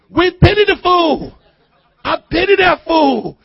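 loud, high shouted catchphrase; Mister T. Retold or imitated. Preacher quoting a catchphrase at congregation, loud mock tough-guy shout. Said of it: "you know what Mister T said".

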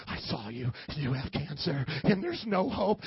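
low mock-menacing doctor; Doctor Angry. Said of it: "Doctor Angry, he just wants to tell you".

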